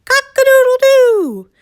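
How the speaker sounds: very high, loud crow with a held end; full crow. Acted. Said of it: "and crowed".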